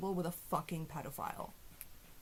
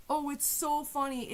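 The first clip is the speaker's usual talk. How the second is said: sarcastic mock; 6 semitones higher than usual. Sarcastic mocking of fans.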